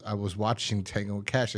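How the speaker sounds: ordinary delivery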